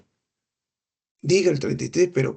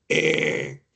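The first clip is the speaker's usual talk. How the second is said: e balada de cabra, más grave; voz de cabra; 11 semitones lower than usual. Shown as a demonstration. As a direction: imita el balido que oye el médico. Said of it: "Eso se llama en semiología voz de cabra". (profesor imita la egofonía, e como cabra)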